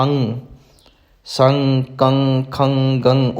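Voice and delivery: ਅੰਗ, ਸੰਗ, ਕੰਗ, ਖੰਗ, ਗੰਗ each ending in a ringing ng; each of ਅੰਗ, ਸੰਗ, ਕੰਗ, ਖੰਗ, ਗੰਗ said separately on ng; ng nasal demonstration. Shown as a demonstration. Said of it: "ਟਿੱਪੀ ਦੀ ਆਵਾਜ਼ ਕੱਢਦੇ ਹਾਂ".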